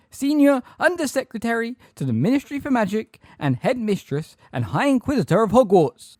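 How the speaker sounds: high, loud recital of titles; Umbridge, high-pitched and terrified. A narrator's reading. Umbridge giving titles to centaurs, high-pitched terror. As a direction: pull rank in a frightened high voice. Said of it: "in a high-pitched, terrified voice".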